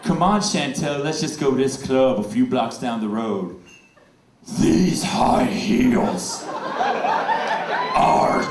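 gravelly Batman as tired drag queen. Retold or imitated. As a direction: rasp like a worn-out drag queen. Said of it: "He sounds like a drag queen".

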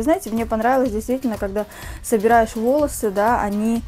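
usual speaking voice

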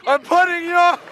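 mocking his buddy for the clip, shouted high; teasing shout. Real, not acted. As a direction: crow it at him. Mocking friend caught on camera.

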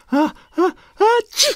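put-on very high baby sneeze; high gasping build-up into an "atchi"; acted sneeze. (éternuement aigu de bébé)

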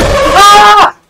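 short high loud yell of fright; scared shout. Real, not acted. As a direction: yelp at the sudden face. Scared by the kid's face.

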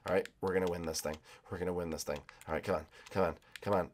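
ordinary talk, nothing marked

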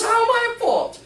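high, loud indignant question; indignant protest. Retold or imitated. Head nurse protesting to the speaker, high indignant outcry. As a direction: play the offended head nurse.

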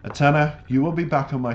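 name called out high and loud; bright excited shout-out of the name. Real, not acted. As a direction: call her name with delight. Greeting the artist on channel.

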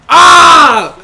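high, loud shout of alarm; startled gamer yell; real shout. Real, not acted. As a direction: yell it out in shock. Reacting to a big play.

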